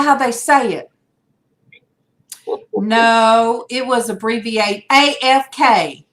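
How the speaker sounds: ordinary delivery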